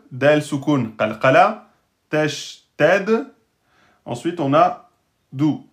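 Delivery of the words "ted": ordinary delivery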